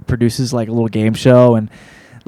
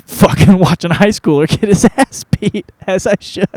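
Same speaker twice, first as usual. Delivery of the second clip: laughing; 8 semitones higher than usual. Real, not acted. Laughing at his own story.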